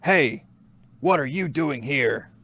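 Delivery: mock retro video game guard calling out an intruder; cheesy game guard. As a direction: ham it up like a stiff nineties game guard. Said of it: "a guard's like".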